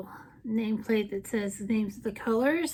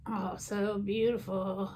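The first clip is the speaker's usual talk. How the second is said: softer, slow, hushed admiration; soft admiring; 3 semitones lower, 7 dB quieter, slower than usual. Real, not acted. Vlogger admiring a swatch in a hushed voice. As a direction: breathe it out in awe.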